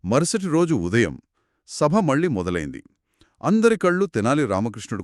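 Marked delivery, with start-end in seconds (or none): none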